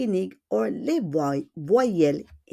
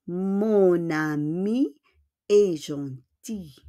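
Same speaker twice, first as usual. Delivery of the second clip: slow, drawn-out "Mon ami", pause, then "est gentil"; slow demonstration; slower than usual. Shown as a demonstration. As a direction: model "Mon ami est gentil" slowly in two separate chunks.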